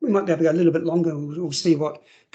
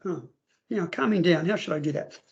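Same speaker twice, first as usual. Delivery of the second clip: ordinary delivery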